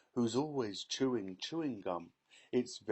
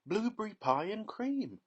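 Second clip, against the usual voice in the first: put-on higher, eager girl's cry; gleeful shout; 11 semitones higher, 4 dB louder than usual. A narrator's reading. Girl tasting magic gum, excited shout. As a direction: announce the treat with delight. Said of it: "shouted Violet".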